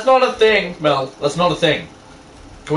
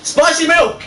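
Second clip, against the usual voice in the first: high, loud yelled catchphrase; excited shout; 12 semitones higher, 5 dB louder, slower than usual. Retold or imitated. Friend shouting a meme catchphrase, excited. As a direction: blurt it out like a meme.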